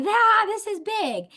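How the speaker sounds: high, loud, drawn-out alarmed cry; hammed-up big-news panic; mock alarm. Acting out alarm bells going off.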